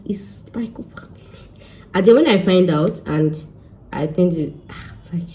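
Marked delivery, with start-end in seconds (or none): 0.0-0.7 whisper. Real, not acted. Trailing whisper.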